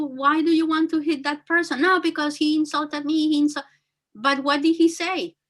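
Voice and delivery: ordinary delivery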